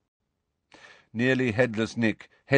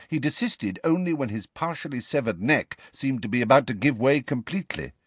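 ordinary delivery

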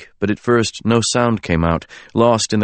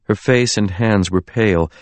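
ordinary delivery, nothing marked

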